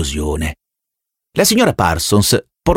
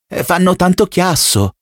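nervous mother; 9 semitones higher than usual. A narrator's reading. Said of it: "le venne da dire".

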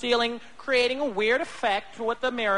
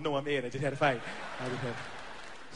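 much lower, fading weak voice; hurt and deflated; 11 semitones lower, 7 dB quieter than usual. Retold or imitated. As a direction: mumble it, beaten up. Bleeding, answering passersby.

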